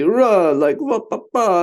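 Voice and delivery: mock Italian outburst, high and loud; hammed-up hot-headed yell; emotional outburst. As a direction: burst out like an excitable Italian. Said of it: "they can be".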